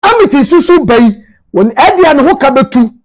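normal talking voice

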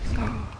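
acted gasp of wonder; awestruck gasp. Miranda spotting the most beautiful man, awed gasp.

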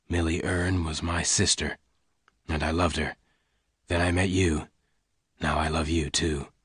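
low, soft, measured revelation; gunman's quiet confession. Acted. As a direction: confide it gently in a low voice. Lassiter reveals his secret.

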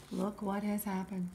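ordinary delivery, nothing marked

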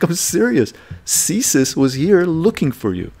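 high, slow, put-on voice of a colleague; a hint of a worried coworker; mock serious colleague. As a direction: slowly press the point in a lightly voiced colleague. Colleague insisting CSIS came.

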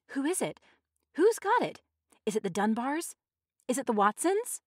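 high, urgent crowd questions; excited questioning. A narrator's reading. Women all asking at once.

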